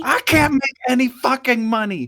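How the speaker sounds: loud, high indignant shout. Acted. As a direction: yell it back at him. Acted argument, shouting back.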